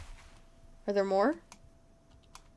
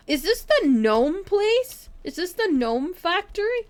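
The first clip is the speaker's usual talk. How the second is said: gasp, then high, drawn-out questions; astonished; 9 semitones higher, 4 dB louder, slower than usual. Real, not acted. Player discovers a possible gnome factory, astonished. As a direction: squeal it in amazed disbelief.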